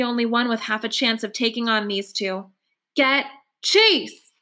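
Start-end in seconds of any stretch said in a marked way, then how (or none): 2.9-4.3 yelling. A narrator's reading. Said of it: "I yell at Kimberly".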